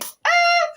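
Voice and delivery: high, loud venting "ah"; exasperated cry. Real, not acted. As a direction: let out the frustration in one high cry. Venting after triggered outburst.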